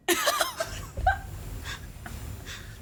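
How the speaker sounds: high breathless cackle; evil high cackle. Pretend. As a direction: cackle like a villain. Evil laugh as Elias.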